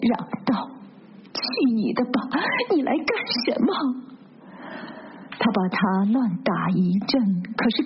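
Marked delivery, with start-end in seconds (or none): 1.3-3.7 mildly indignant cry, pitched higher; 气愤地嚷. A narrator's reading. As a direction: push him off with an annoyed, higher voice. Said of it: "她却气愤愤地挣扎着，嚷道". (气愤愤地挣扎着嚷)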